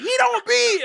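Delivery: hammed-up shout; mock shout. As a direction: blurt it in disbelief. Friend prying shout.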